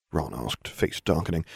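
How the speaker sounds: ordinary delivery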